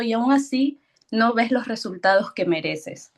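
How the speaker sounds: normal talking voice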